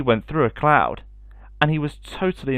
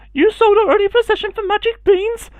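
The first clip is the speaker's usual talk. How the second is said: much higher, louder shocked mother; gasping mother; 6 dB louder than usual. Acted. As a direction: gasp it out as the outraged mother. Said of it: "she gasped".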